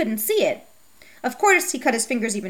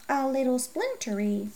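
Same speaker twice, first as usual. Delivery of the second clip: whisper; 6 dB quieter than usual. A narrator's reading. Old man's dry remark.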